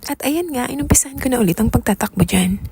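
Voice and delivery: usual speaking voice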